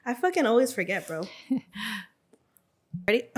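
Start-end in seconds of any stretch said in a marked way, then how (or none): none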